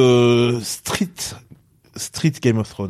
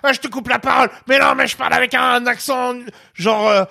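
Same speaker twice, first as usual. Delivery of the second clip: mock frantic yelling; 10 semitones higher than usual. Describing The Bear to a friend in a mock yell. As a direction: rant like the show's shouting chaos.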